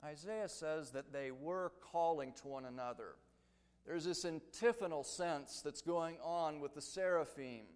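ordinary talk, nothing marked

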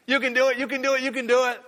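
high, loud cheering goose voice; silly clown. Pretend. Voicing geese cheering each other on.

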